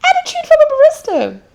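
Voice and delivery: high loud outraged cry with laughter; high loud hammed-up indignant cry; mock outrage. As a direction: act scandalised at the idea.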